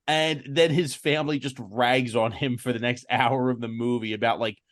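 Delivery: usual speaking voice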